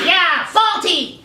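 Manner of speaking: put-on high, drawn-out child's echo; mock-outraged little kid; indignant kid. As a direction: back Mom up in a silly high voice. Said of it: "And I'm like".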